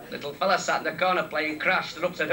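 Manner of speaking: ordinary talk, nothing marked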